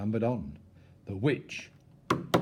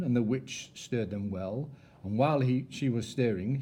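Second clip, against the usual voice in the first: plain reading voice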